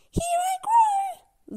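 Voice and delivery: very high, slow little call. Acted. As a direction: call it out, concentrating hard. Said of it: "Lula calls out".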